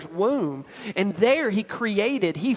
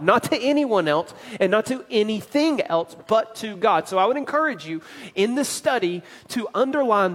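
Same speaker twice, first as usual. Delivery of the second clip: rising preaching; 3 semitones higher, 4 dB louder than usual. Acted. Preaching rises in force.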